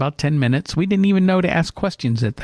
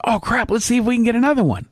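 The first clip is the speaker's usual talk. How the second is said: quick, high-pitched eager outburst; excited reaction; 8 semitones higher, faster than usual. Retold or imitated. Storyteller quotes his younger self wanting another recording, excitedly. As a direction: blurt it fast, keen for more. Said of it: "I was more excited about it".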